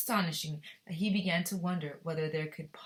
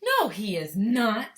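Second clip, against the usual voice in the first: slow, louder, each word pressed; firm denial; 4 semitones higher, 10 dB louder, much slower than usual. Acted. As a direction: defend Wonka firmly and slowly. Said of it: "said Grandpa Joe".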